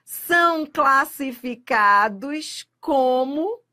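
monossílabos átonos drawn out very slowly; slow emphatic reading. Shown as a demonstration. Teacher reading the exam statement slowly for emphasis. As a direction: stretch monossílabos átonos word by word. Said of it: "Átonos".

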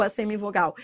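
ordinary talk

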